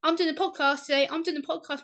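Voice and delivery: high, giddy, can't stop saying it; excited repeat. Retold or imitated. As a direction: repeat the news bursting with joy. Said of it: "I've not seen you this happy".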